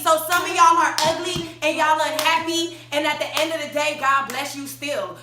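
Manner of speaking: loud, high, emphatic pronouncement; righteous telling-off; full conviction. Retold or imitated. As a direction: preach it hard with total certainty. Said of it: "she put it with so much conviction".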